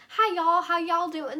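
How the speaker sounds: put-on high, loud try at a Southern accent; attempted Southern accent. Vlogger imagining having the accent she wants, attempted Southern accent. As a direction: greet them in a badly faked Southern drawl. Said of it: "I can't do it correctly".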